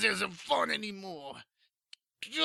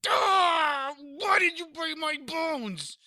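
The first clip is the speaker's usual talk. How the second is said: pained shout; 9 semitones higher, much slower than usual. A narrator's reading. Possessed man yelling at the demon for breaking bones. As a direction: yell through the pain of breaking bones.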